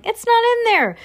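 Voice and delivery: very high, loud yell; cooks' shout. A narrator's reading. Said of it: "they were shouting".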